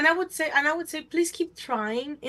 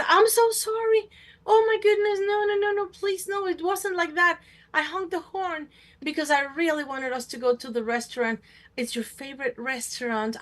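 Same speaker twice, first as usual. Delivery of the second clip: pleading apology; 3 semitones higher than usual. Retold or imitated. Remorseful partner begging forgiveness, high pleading. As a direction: beg desperately, then settle down.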